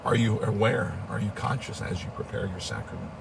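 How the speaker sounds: usual speaking voice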